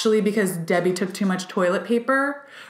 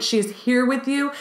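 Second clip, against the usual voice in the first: ordinary talk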